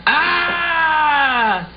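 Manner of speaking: sudden high yelp on waking; a startled wake-up cry; surprised yelp. Acted. Lance startled as his dinosaur pops up, high yelp. Boy jumps out of bed.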